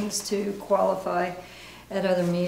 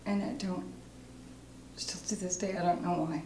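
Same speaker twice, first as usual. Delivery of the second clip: soft, halting confession; ashamed recovering alcoholic; 10 dB quieter than usual. Acted. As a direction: confess it quietly, with long pauses. Acting exercise, recovery meeting confession.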